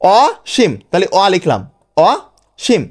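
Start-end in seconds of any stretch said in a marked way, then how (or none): 0.0-0.7 Asim as "aw... shim", higher on the first piece; broken into syllables. Shown as a demonstration. Teacher breaks the word up for students, Asim in two separate syllables. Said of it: "ভেঙে ভেঙে". 2.0-2.8 অসীম with a gap, "aw... shim"; broken into syllables. Shown as a demonstration. Teacher dictates piece by piece, Asim split in two. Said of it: "ভেঙে ভেঙে".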